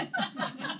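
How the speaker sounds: quick breathy disbelieving laugh; airy snort of a laugh; short laugh. Acted. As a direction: laugh at how ridiculous it is. Laughs at own absurd situation.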